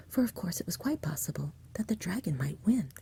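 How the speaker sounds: ordinary delivery, nothing marked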